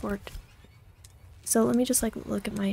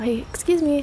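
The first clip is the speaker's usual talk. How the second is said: ordinary talk, nothing marked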